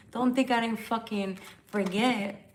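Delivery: ordinary delivery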